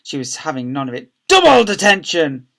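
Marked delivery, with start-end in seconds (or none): 1.3-2.5 loud, high escalating punishment; angry shout. A narrator's reading. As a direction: shout down the headmistress. Deputy head having none of it.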